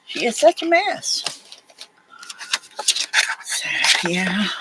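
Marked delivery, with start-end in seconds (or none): 0.1-1.4 high, soft doggy voice; fond coo at the pup; pet talk. Real, not acted. Owner teasing her messy puppy, high soft cooing.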